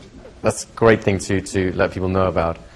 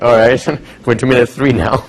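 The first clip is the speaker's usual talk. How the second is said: louder, laughing through the words; laughing aside; 3 dB louder than usual. Retold or imitated. Therapist noticing the clock, laughing and louder. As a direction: laugh at yourself.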